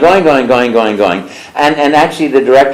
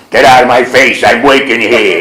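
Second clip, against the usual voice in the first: Brooklyn plumber voice; 3 dB louder than usual. Acted. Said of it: "Italian plumber from Brooklyn".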